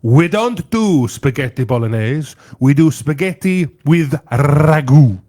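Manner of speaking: put-on slow, loud Italian accent, indignant; proud Italian owner correcting them slowly and loudly; indignant Italian restaurant owner. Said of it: "Wow, that was good, actually, [name]".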